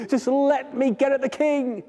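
flea voice, high and desperate, near tears; high pleading flea. Acted. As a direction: beg for food in a high voice. Flea eager.

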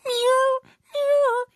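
very high pitiful meows; tiny high crying meow; scared kitten meow. Acted. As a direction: mew like a frightened kitten. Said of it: "pulled back".

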